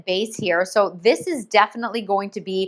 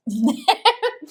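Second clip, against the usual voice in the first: quick embarrassed laugh; short laugh. Real, not acted. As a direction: laugh it off lightly. Laughing at herself mid-brushing.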